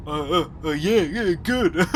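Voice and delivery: lightly voiced stammering man; a hint of a cornered guy stalling; nervous man. Hesitant man answering her small talk, lightly voiced stammer. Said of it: "And he goes".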